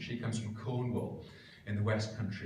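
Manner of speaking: normal talking voice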